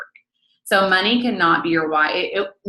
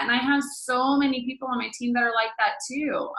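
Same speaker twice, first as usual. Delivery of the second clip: usual speaking voice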